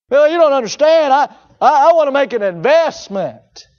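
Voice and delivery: a hint of a defensive listener; mock objecting churchgoer. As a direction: play the protesting skeptic, lightly.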